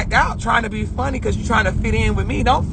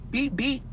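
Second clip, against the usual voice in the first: high, quiet little beeps; car horn imitation; 6 semitones higher, 9 dB quieter than usual. Mocking other people's horns, soft high beeps. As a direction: beep like a weak little horn. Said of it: "y'all horn sound like that song".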